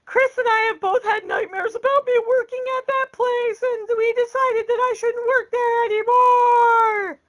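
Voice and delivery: high-pitched mock wailing; exaggerated tearful squeal, stretched at the end; imitated crying. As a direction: wail it out like a hammed-up sobbing daughter. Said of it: "And she's crying harder now".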